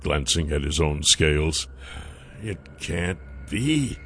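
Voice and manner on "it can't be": soft, slow disbelief; stunned murmur. A narrator's reading. Nath's thoughts turn to ice, murmuring in disbelief. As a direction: murmur it slowly to yourself. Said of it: "he murmured".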